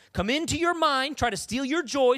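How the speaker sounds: usual speaking voice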